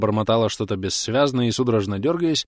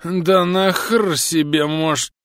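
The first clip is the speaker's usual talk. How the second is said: put-on slow, higher and louder drunken growl; angry drunk; 8 semitones higher, 5 dB louder, much slower than usual. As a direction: start an angry drunken curse. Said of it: "сердито начал техник".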